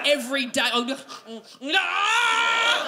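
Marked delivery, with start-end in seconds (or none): 1.8-2.9 loud, high held "aah"; long hollered cry of childish joy; gleeful full-volume kid holler; excited kid yell. Acted. Acting out an overexcited little kid.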